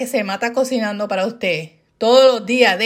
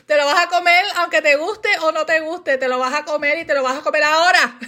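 raised, insistent mock-scolding mom; comic maternal order, higher and speeding up; stern mother; 5 semitones higher, faster than usual. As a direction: lay down the law like a mother, playfully. Mother's command, acted.

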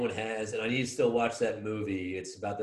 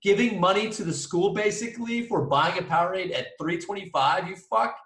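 much higher, louder indignant yelling; student ranting at the vice principal over a vending rule; outraged rant; 9 semitones higher, 6 dB louder than usual. Retold or imitated. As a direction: let the disbelief boil over into swearing.

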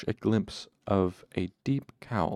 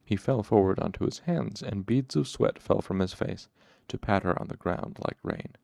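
ordinary delivery, nothing marked

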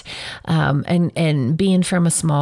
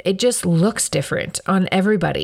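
usual speaking voice

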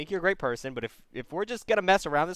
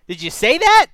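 much higher and louder disbelief; host yells at caller, incredulous; exasperated outburst; 11 semitones higher, 11 dB louder than usual. Real, not acted. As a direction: shout your disbelief at the caller.

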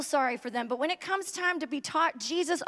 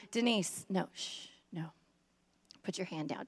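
softer, lower self-scolding hush; hushing myself; 7 semitones lower, 8 dB quieter than usual. Retold or imitated. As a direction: shush yourself down quietly. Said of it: "If I lived back then, I would be".

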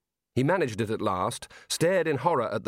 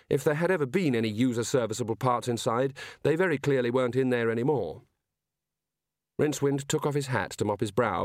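usual speaking voice

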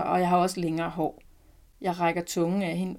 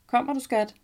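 higher-pitched call to a partner; 6 semitones higher, 4 dB louder than usual. A narrator's reading. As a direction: lightly call downstairs to your darling. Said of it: "Min mand kalder på mig".

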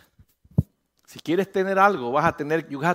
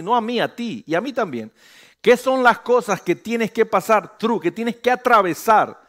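louder, higher passionate sermon; fervent preaching; 5 semitones higher, 6 dB louder than usual. Acted. Pastor challenging the congregation about trials, fervent preaching. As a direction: press the question on the congregation.

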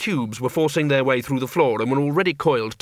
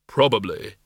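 low, dry voice of Death; deep, deadpan reaper; grim reaper voice; 3 semitones lower than usual. Acted. Death answers.

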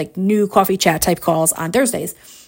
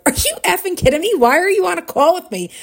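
high, fast, astonished questioning; imitated incredulity; 8 semitones higher than usual. Co-host to lottery winner, high fast incredulous reaction. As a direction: fire it off high in mock outrage.

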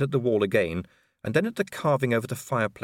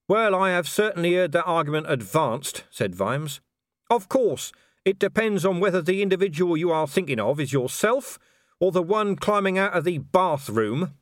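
a dry, understated copper's retort; dry Vimes; 7 semitones higher than usual. A narrator's reading. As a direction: answer flatly, making a point. Vimes voiced in dialogue.

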